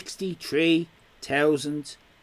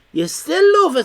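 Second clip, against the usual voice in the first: teasing; 11 semitones higher, 7 dB louder than usual. Acted. Teasing a chat member.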